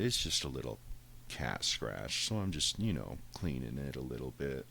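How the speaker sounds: ordinary talk, nothing marked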